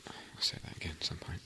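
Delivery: very soft, unvoiced whisper to self; muttered aside. Real, not acted. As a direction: murmur it under your breath, barely audible.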